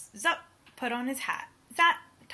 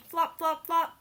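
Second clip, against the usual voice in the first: flopping sound words; 5 semitones higher, 4 dB louder than usual. Acted. Sound words read aloud.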